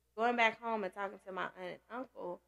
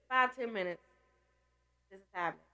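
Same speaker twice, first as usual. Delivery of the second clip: usual speaking voice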